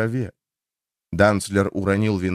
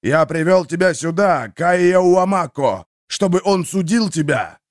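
thundering spirit voice; 6 semitones higher, 4 dB louder than usual. A narrator's reading. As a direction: boom it out like rolling thunder. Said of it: "Низкий ракочущий бас".

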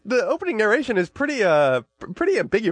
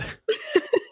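high squeal laugh. Real, not acted. Big laugh.

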